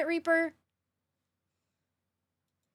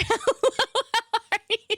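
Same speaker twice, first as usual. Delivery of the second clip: much higher, slow giggly how are you; laughing greeting; 11 semitones higher, much slower than usual. Real, not acted. Streamer greets viewer Desiree while laughing. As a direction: laugh through the hello.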